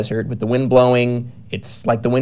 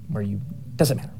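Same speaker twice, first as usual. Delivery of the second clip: ordinary talk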